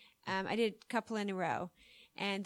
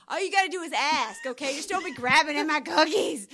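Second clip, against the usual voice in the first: scolding friend; 3 semitones higher, 6 dB louder than usual. Retold or imitated. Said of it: "He's like".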